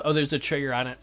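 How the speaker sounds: ordinary talk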